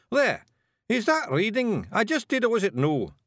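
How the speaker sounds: high, sharp Scots challenge; demanding. A narrator's reading. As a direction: defy anyone to say it isn't reading. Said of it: "and demanded".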